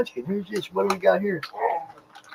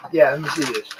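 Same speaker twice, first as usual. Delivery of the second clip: talking with sour candy in mouth; words round a sour candy; eating sour candy; 5 semitones lower, 7 dB quieter than usual. Real, not acted. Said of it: "the most sour candy in the world".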